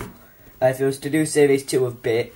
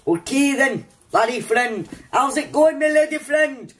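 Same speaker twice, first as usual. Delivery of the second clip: very high comic Scottish voice; squeaky send-up of a Scotsman; badly faked Scottish accent; 12 semitones higher than usual. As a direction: do a terrible Scottish accent, pitched way up. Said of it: "You can't even do a bloody Scottish accent".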